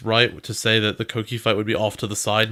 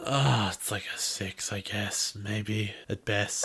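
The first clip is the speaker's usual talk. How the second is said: quiet; 5 semitones lower, 11 dB quieter, much slower than usual. Real, not acted. Grudging low rating.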